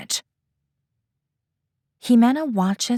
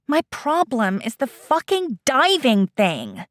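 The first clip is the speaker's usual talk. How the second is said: exasperated, high and strained; frustrated outburst; 6 semitones higher than usual. Acted. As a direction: blurt out the frustration.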